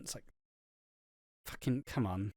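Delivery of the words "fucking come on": ordinary talk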